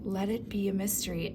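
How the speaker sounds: ordinary talk, nothing marked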